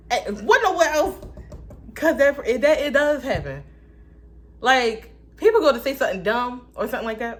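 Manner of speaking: worked-up rush of words; fired up. Real, not acted. Excited rant about rude people.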